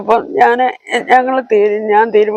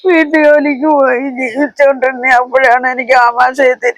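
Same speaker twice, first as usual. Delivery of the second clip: real crying while recounting illness; tearful, pleading account; 3 semitones higher than usual. Real, not acted. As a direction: tell it through tears. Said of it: "ഇനി കരയരുത് കേട്ടോ".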